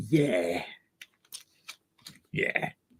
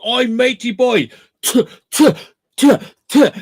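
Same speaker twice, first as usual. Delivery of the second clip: higher, louder spitting sounds; mock spitting noises; 7 semitones higher, 5 dB louder than usual. Friend imitating Chinese spit fighters, mock spitting. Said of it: "they run up and like".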